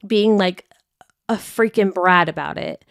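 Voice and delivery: ordinary talk, nothing marked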